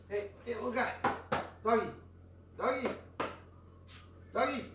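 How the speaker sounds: high, sing-song call to a dog. Real, not acted. Owner calling a barking dog in the yard, high coaxing call.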